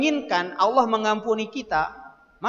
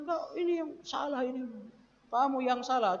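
higher, softer mimicked accuser; retold accusation; 5 semitones higher, 8 dB quieter than usual. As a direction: lightly mimic someone pinning the blame. Retold drivers accusing him of fault.

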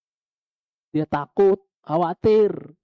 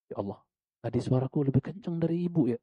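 much softer, dismayed inner voice; remorseful murmur; 7 dB quieter than usual. Retold or imitated. Preacher voicing the son's guilty thought, soft and dismayed. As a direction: whisper it to yourself in regret. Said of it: "Dia mikir".

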